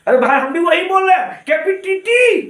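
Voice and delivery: high, loud indignant mother; angry mother. Retold or imitated. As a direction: snap back like an offended mom. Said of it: "मैया गुस्सा गई". (गुस्से में ऊँची तेज़ आवाज़ में माँ)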